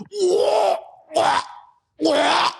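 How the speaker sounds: high squeaky gag noises; mock gagging. Mocking the gag that nobody ever does, a high mock gagging sound. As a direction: imitate someone choking on it. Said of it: "no one's ever been like".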